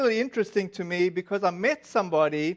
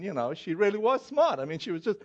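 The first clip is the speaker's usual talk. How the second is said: usual speaking voice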